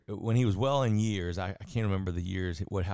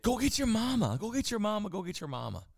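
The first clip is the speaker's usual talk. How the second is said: much higher, urgent, a mock old man's cry; frantic father; 12 semitones higher than usual. Retelling Edison's father calling to his son, high and urgent. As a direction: urge him to fetch mama, pitched high. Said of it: "his father said".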